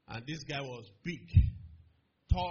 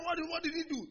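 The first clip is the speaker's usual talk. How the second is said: puzzled crowd; 11 semitones higher than usual. Retold or imitated. Crowd asks the bully.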